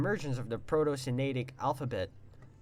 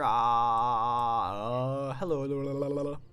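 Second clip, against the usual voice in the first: louder hello testing the mic; louder repeated mic-check hellos; 3 semitones lower, 6 dB louder, faster than usual. Shown as a demonstration. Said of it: "Making myself louder".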